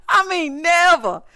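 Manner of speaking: "never" drawn out and pitched high; emphatic, stretched "never" for finality; stress on NEVER. Real, not acted. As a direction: lean slowly and hard on "never". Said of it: "When I say never".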